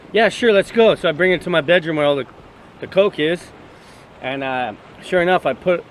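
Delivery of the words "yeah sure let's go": put-on eager girl quote. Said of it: "this girl's like".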